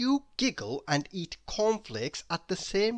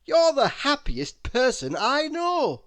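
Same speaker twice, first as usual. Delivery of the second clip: loud, much higher, hearty voice; jolly Mister Happy; 10 semitones higher, 7 dB louder than usual. Acted. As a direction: cheer her up loudly.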